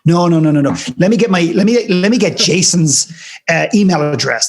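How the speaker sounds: high, fluttery protest brushing off the praise; flustered modest refusal. Retold or imitated. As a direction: squeak it out, waving the idea away. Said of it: "she did what almost everybody does".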